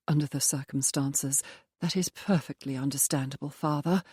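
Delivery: ordinary delivery, nothing marked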